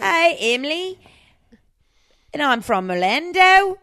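Australian accent. Shown as a demonstration. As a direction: attempt Aussie, slide into British English. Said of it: "It turns British".

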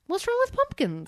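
very high hillbilly voice; mock Southern offense. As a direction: defend pumpkins indignantly. Back into the bit.

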